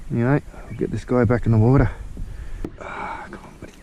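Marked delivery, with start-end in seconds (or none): 2.8-3.7 soft coaxing whisper. Real, not acted. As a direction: breathe it gently to the fish. Coaxing released fish back.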